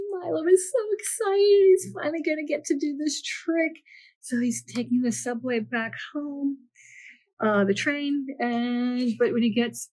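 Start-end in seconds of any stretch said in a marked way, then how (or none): 0.1-3.7 very excited narration. A narrator's reading. Reading to children about Milo's joy, gleefully excited. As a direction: squeal it out with glee.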